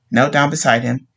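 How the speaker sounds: ordinary delivery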